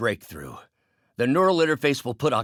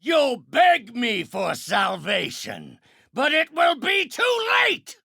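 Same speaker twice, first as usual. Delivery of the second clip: high, rising villainous shout; menacing threat; 9 semitones higher than usual. Acted. Villain warns foe salvation comes too late, rising menacing shout.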